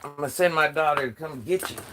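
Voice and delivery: ordinary delivery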